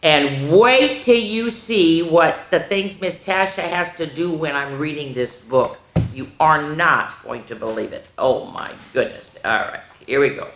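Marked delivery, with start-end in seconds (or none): none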